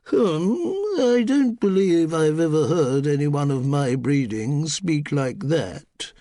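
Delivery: a haughty hum, then slow, drawn-out disdain; disdainful dowager. Acted. The Duchess dismissing the housemaid's voice, disdainful. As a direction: sniff at the very idea.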